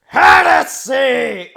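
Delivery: slow, roaring high zealot shout; yelling heresy. Acted. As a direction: bellow it like a fanatic inquisitor. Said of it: "Already I'm yelling heresy".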